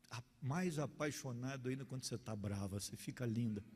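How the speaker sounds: low, soft sweet talk; sweet-talking husband. Retold or imitated. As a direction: imitate a husband soothing his angry wife tenderly. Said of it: "Ia dizer".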